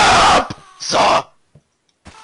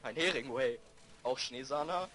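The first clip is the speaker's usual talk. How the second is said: ordinary talk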